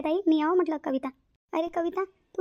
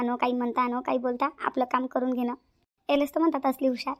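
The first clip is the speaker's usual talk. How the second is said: normal talking voice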